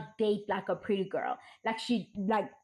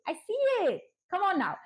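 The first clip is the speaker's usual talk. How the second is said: excited call-out; 8 semitones higher than usual. Real, not acted. Calling out the comments.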